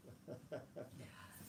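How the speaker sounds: soft, voiceless laugh; quiet chuckle. Real, not acted. Teacher after the choke demonstration, quiet chuckle. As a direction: chuckle low under the breath.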